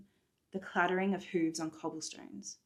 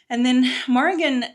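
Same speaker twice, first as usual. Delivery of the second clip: loud dramatic telling; 6 semitones higher, 11 dB louder than usual. A narrator's reading. Narrator building up confrontation.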